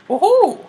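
high, squeaky woohoo cheer; hammed-up Mario whoop. Mario cheering as he sets off, high woohoo. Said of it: "really bad Mario impression".

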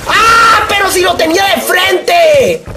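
real frustrated yell at the game; furious shout. Real, not acted. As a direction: shout at the missed target in disbelief. Misses enemy in game.

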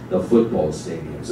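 ordinary delivery

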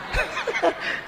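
soft unvoiced high laugh; breathy laugh. Real, not acted. Preacher laughs softly at his own joke, breathy high laugh. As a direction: laugh through the punchline.

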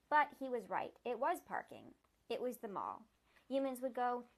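steady read-aloud storytelling voice; story narration. A narrator's reading. Reader telling the fox's story, even narration. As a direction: read the fox's tale plainly to listeners.

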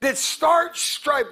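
slow, drawn-out, fervent; slow preaching. Real, not acted. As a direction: stretch every word to the church. Preacher slowing into intense appeal.

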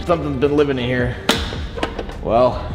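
ordinary talk, nothing marked

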